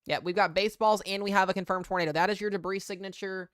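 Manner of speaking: loud, urgent storm report; breathless shouting over wind. Real, not acted. Confirmed tornado, debris signature live.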